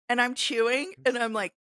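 ordinary talk

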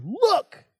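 high, rising, indignant "look"; disrespectful. Acted. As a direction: cut in defiantly on a parent. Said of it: "disrespects his father by saying".

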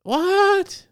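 very high, loud disbelief; stunned at cooked placenta; shocked. Real, not acted. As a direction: react in pure disbelief.

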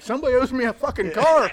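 loud high mock yell; furious yell, hammed up; retold outburst. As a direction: shout it out, half joking.

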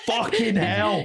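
high, slow, hammed-up cursing through laughter; laughing mock outburst. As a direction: curse it out while cracking up. Jigsaw furious at broken trap.

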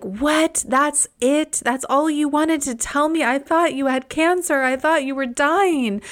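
high, stunned mother, in disbelief; mother blurting out her surprise; mom's shocked reaction. Retold or imitated. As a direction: play the relieved, startled mom. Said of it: "she was like".

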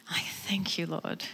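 breathy whispered thank-you, nearly silent. Real, not acted. Speaker thanking God under her breath, whispering.

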